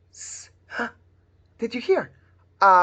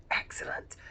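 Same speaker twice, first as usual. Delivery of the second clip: 1 whispering; 13 dB quieter than usual. Real, not acted. Quiet praise during secret fingers.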